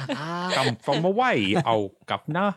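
hammed-up bad English accent, slow start; American faking English accent. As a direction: badly fake an English accent, dragging it out. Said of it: "American doing an English accent".

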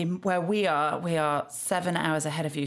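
ordinary delivery

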